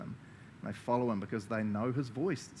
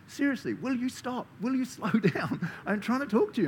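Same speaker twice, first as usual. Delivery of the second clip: higher mock-exasperated God voice; God's exasperated voice; 10 semitones higher than usual. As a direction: play God pleading with a busy pastor. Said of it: "I often imagine God's like".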